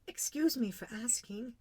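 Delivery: put-on high-pitched, tentative child; politely risk a question to royalty; venturing little prince. A narrator's reading. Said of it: "he ventured".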